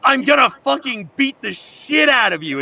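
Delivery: mock furious yell at Dio, high and loud; furious shout. Jonathan bursting in, yelling at Dio in mock fury. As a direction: bellow it like an enraged hero. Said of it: "kicks down the door and is like".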